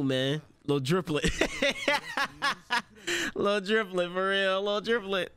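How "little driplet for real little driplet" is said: higher, giggly delight; amused. Real, not acted. Fan tickled by the nickname, amused and giggly. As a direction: pitch it up on the tail of a laugh. Repeats nickname after cracking up.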